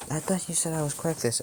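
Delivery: put-on high-pitched confused boy; puzzled protest. Boy reminds doctor what he was told, high puzzled voice.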